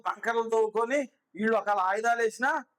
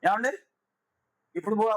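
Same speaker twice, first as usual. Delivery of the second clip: ordinary talk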